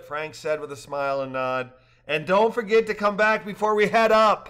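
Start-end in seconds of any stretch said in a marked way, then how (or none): 2.1-4.5 louder call after them; holler. A narrator's reading. Guide hollering after the leaving rafters. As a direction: call out to departing backs. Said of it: "Pyatt hollered".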